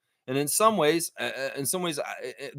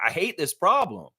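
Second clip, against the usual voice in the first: normal talking voice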